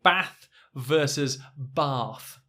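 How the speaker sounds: Bath short a then Bath long ah, slow; contrast of bath vowels. Shown as a demonstration. Teacher slowly contrasts the two Bath vowels. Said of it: "let's say".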